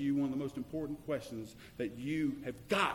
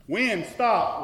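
commanding shout; 4 semitones higher, 8 dB louder than usual. Acted. Voicing Jesus rebuking the wind.

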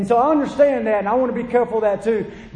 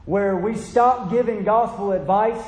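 usual speaking voice

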